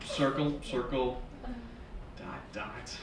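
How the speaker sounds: quiet, slowly spaced recall; hushed, dawning realization; pondering. Acted. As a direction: murmur it to yourself, fading almost to a whisper. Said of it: "thinking about the cootie shot".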